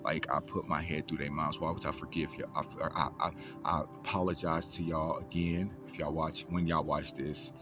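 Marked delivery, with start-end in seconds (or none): none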